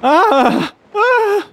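high cries breaking off the words; panting fright. A narrator's reading. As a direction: struggle to speak through terror. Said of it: "panted the other".

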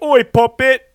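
loud, hammed-up English accent; bad English accent. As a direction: bellow it in a badly faked English accent. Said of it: "My English accent is pretty bad".

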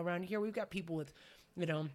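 ordinary talk, nothing marked

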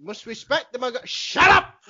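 starts plain, then a loud high shut up; imitating a wrestler losing patience; mock yell. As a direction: cut off mid-sentence with a shout.

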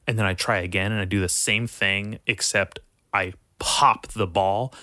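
ordinary talk, nothing marked

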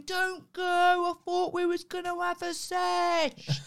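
a lightly voiced lad, high and dragged out slow; slow-motion lad. Imitating the Essex lad chasing her in slow motion. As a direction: stretch it like a slow-motion replay. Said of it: "running after me in slow motion going".

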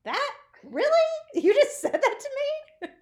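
high, incredulous rising questions; responding to a tactless stranger, sounding incredulous; stunned disbelief. Retold or imitated. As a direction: act out your shock at what she just said. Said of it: "I'm just looking at her like".

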